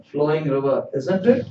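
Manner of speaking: ordinary talk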